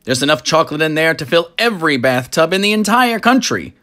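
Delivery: high, loud, gushing showman; delighted factory owner showing off; boastful chocolatier. Acted. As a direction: brag about the river with rising glee. Wonka boasts of river.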